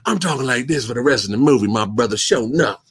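low, quick mock Southern dub; Steven Seagal. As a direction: put on a low Southern tough-guy voice. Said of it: "you would hear Steven Seagal go".

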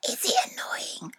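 tiny teasing whisper. Pretend. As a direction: whisper just to annoy Dad. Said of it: "when I talk like this".

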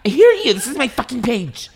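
high, loud shrieking rant; scary influencer impression. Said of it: "my impression of that is, like, so scary".

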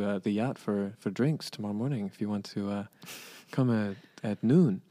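lightly voiced courteous messenger; a hint of hesitant formal politeness; polite envoy. Yacht's envoy invites family for drinks, lightly voiced polite envoy.

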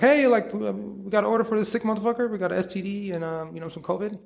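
a chirpy courier calling out the order; mock delivery dispatcher. Delivery dispatcher announcing sick order.

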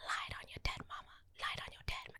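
"Lie it on your dead mama" whispered, no voice, very soft; ASMR whisper. Retold or imitated. Vlogger whispering the song's creepy outro line. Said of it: "this weird A S M R rant".

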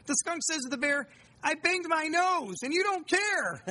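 put-on high, slow little-kid voice; young son's voice. Father imitating his five-year-old telling a story, high child voice. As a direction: play the small boy telling his story.

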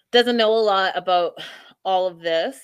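ordinary talk, nothing marked

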